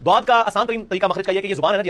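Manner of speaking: ordinary talk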